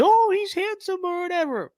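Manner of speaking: high mock swooning voice; mock a swooning fan, high; mocking girly voice. Mocking women voters' voice.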